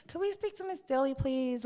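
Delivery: lightly voiced polite school caller; mock school office voice. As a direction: imitate a prim school secretary. School staff calling mum.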